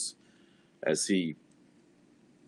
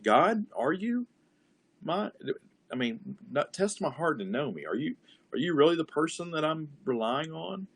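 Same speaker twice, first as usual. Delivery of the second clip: searching question to God, raised pitch; prayer to God; 6 semitones higher than usual. Shown as a demonstration. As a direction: ask God earnestly. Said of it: "talking to God, having a conversation".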